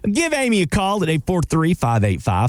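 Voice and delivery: phone number read with a light Southern twang; Southern twang showing. Shown as a demonstration. Host shows his Southern twang on the number, lightly. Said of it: "I don't think I have a Southern twang, but then I'll talk about".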